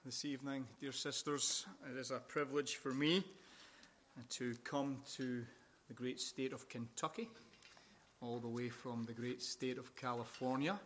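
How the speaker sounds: soft, unhurried greeting, each phrase spaced out; talking slowly. Real, not acted. Greeting the Kentucky church, deliberately slow and soft. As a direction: ease them in gently so they tune to the accent. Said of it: "I'm talking slowly".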